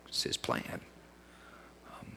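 a hushed, breathy aside; whispered aside. Real, not acted. Preacher whispering an aside to the congregation.

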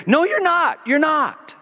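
high, loud insistent cut-in; Jesus voiced as a high insistent interruption; Jesus interrupting. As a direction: break in and insist, lifted voice. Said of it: "Jesus kept interrupting and saying".

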